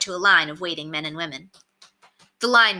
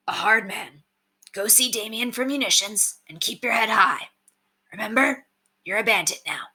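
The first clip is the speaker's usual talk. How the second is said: lightly voiced bandit mentor, easygoing; a hint of a rough old bandit showing the new kid the ropes; Brendan's voice; 4 semitones higher than usual. A narrator's reading. Brendan encouraging Curtis.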